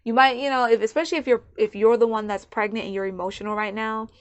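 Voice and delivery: ordinary delivery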